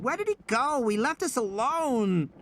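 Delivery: put-on a small girl realising she's been abandoned; frightened little girl. Lex finds that Ed Regis has left them, frightened child. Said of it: "Lex said".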